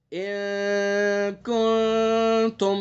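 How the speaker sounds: ان کنتم slowly held, with the nasal hidden; drawn-out ikhfa. Shown as a demonstration. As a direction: stretch the hidden nun of کنتم. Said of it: "جیسے".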